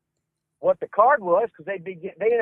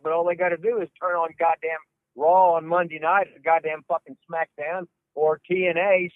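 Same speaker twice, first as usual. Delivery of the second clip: ordinary talk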